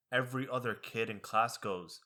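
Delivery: normal talking voice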